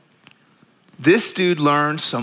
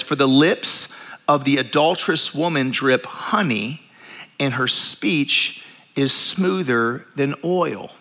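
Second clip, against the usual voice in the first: scripture reading; 5 semitones lower, slower than usual. Acted. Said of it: "reading Proverbs aloud".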